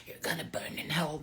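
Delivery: ominous whisper; whispered warning of damnation. Retold or imitated. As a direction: hush it like a voice of guilt in your head. Voicing what guilty women think.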